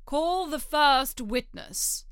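slow, commanding royal order; king voice. Acted. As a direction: announce it like a pompous king.

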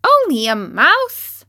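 high, slow, offended squeak; outraged little mouse; indignant cry. Acted. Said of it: "cried the little animal indignantly".

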